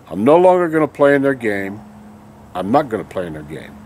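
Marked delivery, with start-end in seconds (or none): 2.5-3.7 quieter repeat. Real, not acted. Vlogger repeats his refusal to viewers, lower and softer. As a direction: lower and softer, half to himself.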